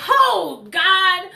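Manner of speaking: high, loud shocked gasp of words. Retold or imitated. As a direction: react stunned at the confession. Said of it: "she was like".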